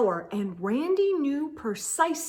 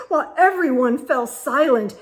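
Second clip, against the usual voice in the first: usual speaking voice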